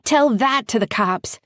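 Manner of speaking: loud, high yell; screaming. A narrator's reading. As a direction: keep yelling across the street. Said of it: "I screamed".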